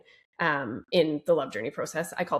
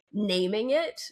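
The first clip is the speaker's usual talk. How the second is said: normal talking voice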